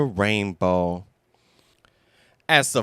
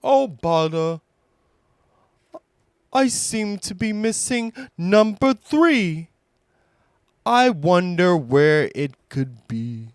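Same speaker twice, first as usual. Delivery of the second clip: higher, gentle bear voice; Pooh voice; 4 semitones higher than usual. Acted.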